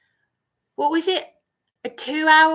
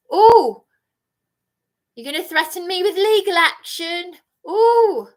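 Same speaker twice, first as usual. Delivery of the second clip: high, loud scoffing "Oh"; mock them for threatening legal action; sarcastic outburst; 6 semitones higher, 8 dB louder than usual. Real, not acted. Scoffing at a legal threat, high and loud.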